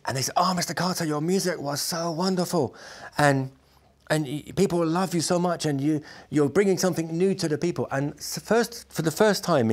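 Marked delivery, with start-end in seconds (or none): 0.4-7.9 higher, gushing mock journalist voice; imitating eager journalists; retold excited praise. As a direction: gush like a delighted host.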